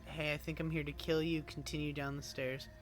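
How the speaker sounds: ordinary talk, nothing marked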